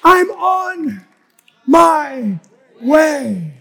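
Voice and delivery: loud, high, drawn-out exclamations; full-force preaching shout. Real, not acted. Preacher exclaiming to God that he's on his way, shouting. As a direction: cry it out to the whole congregation.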